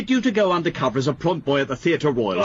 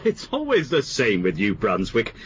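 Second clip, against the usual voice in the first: normal talking voice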